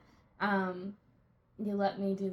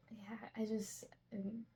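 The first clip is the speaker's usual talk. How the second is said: breathy whispered aside; 11 dB quieter than usual. Real, not acted. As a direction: drop to a whisper. Unvoiced low aside.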